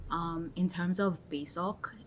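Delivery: normal talking voice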